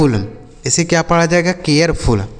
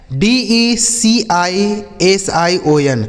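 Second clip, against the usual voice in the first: ordinary talk, nothing marked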